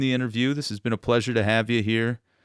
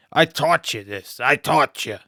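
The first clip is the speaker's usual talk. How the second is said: louder, higher scolding father voice; imitating his father; 5 semitones higher, 8 dB louder than usual. Son imitates his construction worker father scolding him. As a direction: scold like an impatient dad. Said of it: "That's how he would talk".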